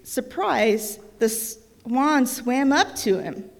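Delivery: storybook reading voice, lively; reading to children. A narrator's reading. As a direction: read it aloud like a bedtime book. Reads picture book to child.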